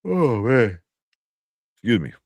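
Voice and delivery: talking through a yawn; words stretched by a yawn. Real, not acted. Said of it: "I don't even know I'm yawning".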